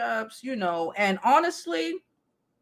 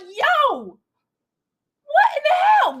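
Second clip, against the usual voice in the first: much higher, shouted disbelief; mock outraged shout; 6 dB louder, slower than usual. Seeing them all in the photo, a high shocked shout.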